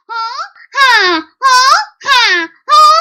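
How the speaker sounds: loud, high forced ha ha breaths; breathing exercise huffs. Shown as a demonstration. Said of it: "like that, in, out tummy exercise".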